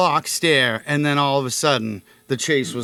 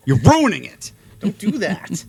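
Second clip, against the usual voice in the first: mock scolding; 4 semitones higher, 6 dB louder than usual. Scolding rude beachgoers.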